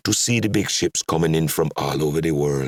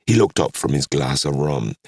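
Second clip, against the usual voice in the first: plain reading voice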